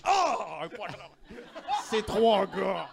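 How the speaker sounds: loud, high shocked exclamation; surprised passerby. Retold or imitated. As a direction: yelp it like a startled onlooker. Imite les passants surpris. (cri de surprise)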